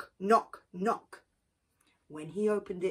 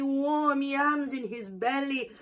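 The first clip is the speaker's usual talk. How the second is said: plain reading voice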